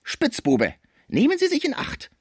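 very high-pitched counter-threat; threatening Frisier. A narrator's reading. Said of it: "Man trennte sich unter Drohungen". (drohend, mit sehr hoher Stimme)

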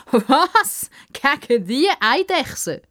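high, scoffing disbelief; incredulous, high-pitched mockery; mocking laughter. A narrator's reading. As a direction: jeer with a laugh before it. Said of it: "Der Bruno fängt laut an zu lachen".

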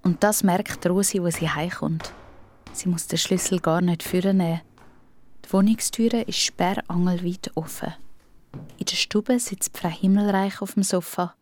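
usual speaking voice

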